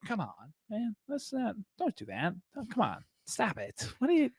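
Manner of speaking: high, soft whiny pleading; mock pleading Spider-Man. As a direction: beg him to stop, softly and high. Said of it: "he's like".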